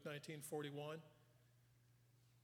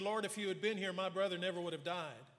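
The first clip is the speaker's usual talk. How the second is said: raised, louder pleading with mild reproach; hint of desperation; 3 semitones higher, 7 dB louder than usual. Martha at Jesus' feet over her dead brother, loud desperate reproach. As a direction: plead it out loud, half accusing. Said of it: "hint of desperation in that".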